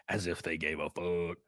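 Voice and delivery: low sighing mutter. Real, not acted. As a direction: sigh it out, barely voiced. Sighed low aside.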